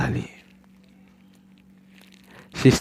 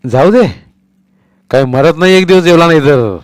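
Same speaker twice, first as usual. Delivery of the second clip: angry retort; 7 semitones higher, 8 dB louder than usual. Acted. Father dismisses wife angrily.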